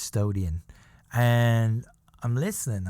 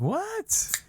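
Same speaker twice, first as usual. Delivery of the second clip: surprised. Retold or imitated. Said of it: "I'm like".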